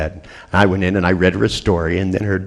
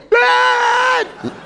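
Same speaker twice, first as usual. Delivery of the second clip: put-on frightened child's cry. Daughter in the dark calling for dad, high frightened shout. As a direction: shriek like a scared little girl. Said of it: "I heard her go".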